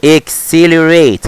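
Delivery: ACCELERATE very slowly, syllable by syllable; slow-motion teaching take of "Accelerate". Shown as a demonstration. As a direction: stretch out "Accelerate" as ek-se-le-rate. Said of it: "هنطقها بالنطق بقى slow motion".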